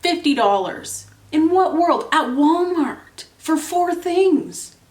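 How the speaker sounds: loud, high, disbelieving outcry, slowing on the last words; outraged exclamation. Real, not acted. Ranting to viewers about a fifty-dollar Walmart bill, loud and incredulous. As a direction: shout it like the receipt just insulted you.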